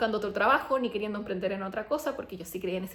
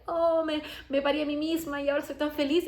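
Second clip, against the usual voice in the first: mock blissed-out classmate; 5 semitones higher than usual.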